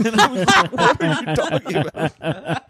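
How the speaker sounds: high, incredulous words through laughter; laughing protest. Real, not acted. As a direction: crack up while retelling your own reaction. Laughing while retelling his reaction.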